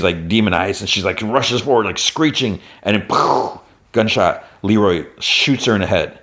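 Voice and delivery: usual speaking voice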